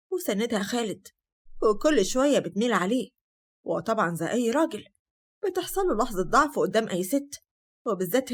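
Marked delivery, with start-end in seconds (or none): none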